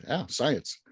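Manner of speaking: normal talking voice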